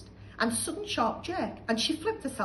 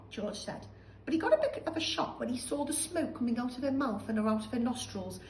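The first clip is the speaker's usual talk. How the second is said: usual speaking voice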